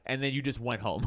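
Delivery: ordinary delivery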